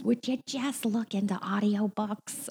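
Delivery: nagging mother. Retold or imitated. Mother urging her struggling actor child toward audiobooks, nagging. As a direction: imitate a mother pleading, stretching the words. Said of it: "my mother goes".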